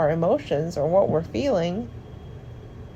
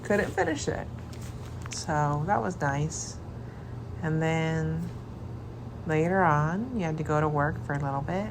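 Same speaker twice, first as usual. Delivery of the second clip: hushed; 7 dB quieter than usual. Real, not acted. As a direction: talk softly beside a sleeping partner. Partner asleep beside the speaker.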